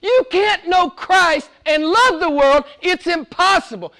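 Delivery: high, slow, anguished protest; John's exclamation. Retold or imitated. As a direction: plead like John refusing to believe it. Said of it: "this is the exclamation of John".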